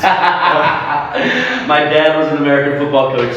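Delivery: amused, laughing slowly. Real, not acted. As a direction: chuckle it out after the soccer joke. Joking after soccer jab, laughing.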